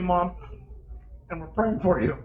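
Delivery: ordinary delivery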